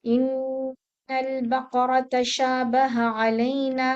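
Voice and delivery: drawn-out chanted Quranic recitation; slow recitation. Shown as a demonstration. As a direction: chant it slowly and evenly. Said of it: "हा को लंबा नहीं करेंगे".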